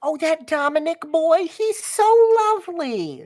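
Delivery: high, loud doting mom voice; gushing mother. Acted. Mother praising her child's friend, high gushing voice. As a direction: gush over the boy in a high voice. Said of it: "Your mother".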